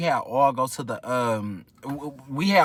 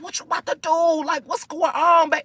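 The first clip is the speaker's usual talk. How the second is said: high excited; 12 semitones higher than usual. Retold or imitated. Nosy friend asks eagerly.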